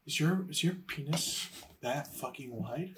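low, soft, slow retold question; hint of curious girl. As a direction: ask it slowly and discreetly, lightly acted. Retold girl's blunt, awkward question.

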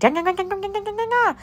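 very high, singsong nonsense syllables; mock foreign chatter. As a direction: imitate gossiping in an unknown language. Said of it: "they gonna say something about your feet".

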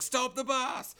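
high-pitched urgent squeal without extra volume; sudden high mock alarm; mock panicked cry. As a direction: imitate someone suddenly panicking, voice jumping up high. Retold sudden cry to halt bus.

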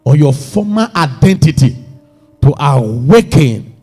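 deliberate, declaimed Bible reading; emphatic scripture reading. Acted. Preacher reads the Passion Translation to the congregation, emphatic declaiming. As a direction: read scripture out with weighty emphasis.

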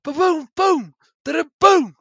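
high loud shouted meme voice; meme catchphrase. Retold or imitated. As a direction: belt it like the old TV meme. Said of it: "E vais começar a fazer".

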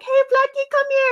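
high sing-song call to the hamster; calling pet. Retold or imitated. Calling Blackie the hamster, high sing-song. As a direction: call her high and sweet.

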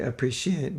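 "appreciate" with a syllable lightly stretched; extended syllable. Shown as a demonstration. Speaker wondering how South Africans say it, lightly extended "appreciate". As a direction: lean a little on the syllables of "appreciate". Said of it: "extend syllables".

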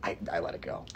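whispered to himself. Acted. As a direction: breathe it out in a whisper. Repeating the line to himself.

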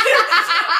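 bursting out laughing; big high laugh; loud laugh. Real, not acted. Laughs at own story.

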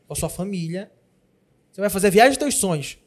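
usual speaking voice

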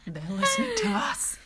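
high-pitched words through laughter; laughing aside. Real, not acted. Co-host noticing they're overheard, laughing. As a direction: squeal it while laughing.

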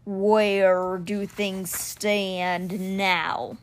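very slow, drawn-out kindly question; gentle stone cheetah. A narrator's reading. Stone cheetah asks the boy how things stand, gently. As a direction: ask gently, stretching every word. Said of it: "In a gentle voice".

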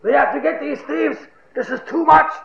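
high-pitched, increasingly loud protest; vocal mock outrage. Actual thief joining the pursuers, hammed-up indignant outcry. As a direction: play the loudest voice against thieves. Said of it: "he was one of the most vocal".

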